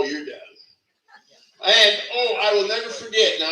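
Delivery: ordinary talk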